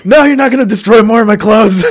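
high, loud pleading refusal; loud protest. Retold or imitated. The patient stopping the medics cutting his shirt, loud and high. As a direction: shout them off the shirt. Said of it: "I'm like".

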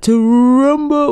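drawn-out announcer shout; ring announcer. Retold or imitated. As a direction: stretch it like a boxing announcer. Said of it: "whatever the fuck he does".

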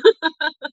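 high, loud nervous giggle; nervous laugh. Real, not acted. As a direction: burst out in a jittery laugh. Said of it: "I'm going to laugh a lot because I'm really nervous".

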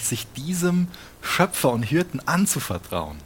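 normal talking voice

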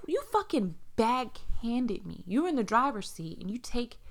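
usual speaking voice

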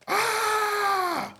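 put-on high squealing scream of excited kids; mock kids' scream. Retelling the kids' scream at the court, a high squeal. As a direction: shriek like a kid seeing a star.

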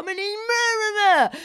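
high, loud, drawn-out mock scream; wailing comic shriek, re-enacted; retold screaming. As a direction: scream the insult slowly, hammed up. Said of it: "jag bara skrek allting så här".

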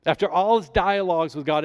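normal talking voice

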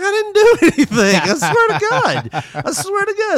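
very high, loud, laughing plea of innocence; pleading kid. Retold or imitated. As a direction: beg like a kid swearing innocence. Kid protesting to angry mom.

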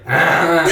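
loud high Ah; startled yell. Retold or imitated. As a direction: yell like you were just woken. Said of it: "yelled at me in sounds".

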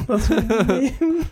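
high laugh through the words. Real, not acted. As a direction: giggle the line out slowly. Laughing at own tough name.

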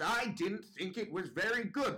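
put-on high, swooping old-woman voice; unrelenting old judge. Old judge ignoring the shushes, high and insistent. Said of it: "And Margaret goes".

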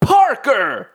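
high calling shout; calling Parker. Retold or imitated. Villainess calls her ninja, mock shout. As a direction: call out for the henchman. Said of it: "jag tror hon säger".

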